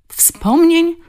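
high, loud echo of his word; sharp challenging retort; incredulous interruption. A narrator's reading. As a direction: cut in, throwing the word back as a question. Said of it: "przerwała mu".

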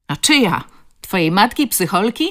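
echoed taunt. A narrator's reading. Second bully joining in against the girl, jeering. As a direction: chime in with the bully's mockery. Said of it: "zawtórował kolejny wyrostek".

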